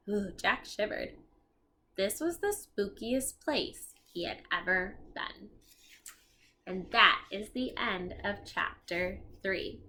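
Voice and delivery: ordinary delivery, nothing marked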